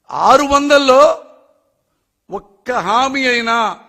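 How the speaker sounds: slow, loud, pointed; slow emphatic challenge. Real, not acted. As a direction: drag it out to press the point. Challenging the politician's promises.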